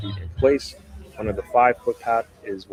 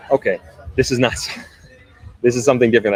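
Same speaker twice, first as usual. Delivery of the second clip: fast, louder laughing realization; surprised laugh; 3 semitones higher, 7 dB louder, much faster than usual. Real, not acted. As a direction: laugh at your own misreading, quickly. Surprised misreading article, laughs.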